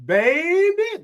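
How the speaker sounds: put-on "Baby" drawn out, high, with a country twang. Shown as a demonstration. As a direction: stretch "Baby" long and swoop it up high. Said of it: "that's how I say it".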